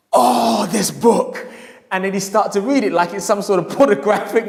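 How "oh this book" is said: awed sigh. Retold or imitated. Customer in awe of the book.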